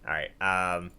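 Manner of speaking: usual speaking voice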